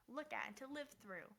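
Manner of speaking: usual speaking voice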